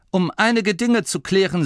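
brightly reassuring, a little forced; forced cheerfulness. A narrator's reading. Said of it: "versuchte, fröhlicher zu klingen, als er sich fühlte".

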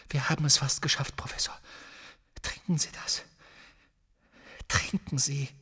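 near-whispered urging; hushed coaxing. Acted. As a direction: urge him softly, almost without voice. Harry coaxes the weakened professor. (fast geflüstertes Zureden)

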